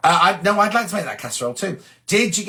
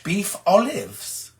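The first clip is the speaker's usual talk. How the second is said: lightly put-on posh, slow and falling at the end; mock posh; much slower than usual. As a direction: savour it slowly, a hint of poshness. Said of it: "Ooh, that sounds posh".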